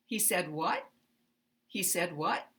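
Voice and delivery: He said what rising on what; uptalk. Shown as a demonstration. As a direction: pitch up on what as a question. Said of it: "rising intonation".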